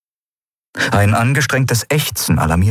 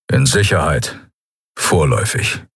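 gruff reply; 5 semitones lower than usual. A narrator's reading. Keru answers gruffly.

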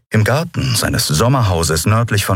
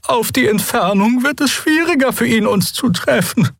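much higher character voice; uneasy crew member. A narrator's reading. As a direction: explain it high and uneasily. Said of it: "Xea faltete unruhig die Hände". (hohe, unruhige Figurenstimme)

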